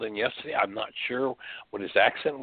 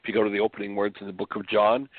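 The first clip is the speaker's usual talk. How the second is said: ordinary talk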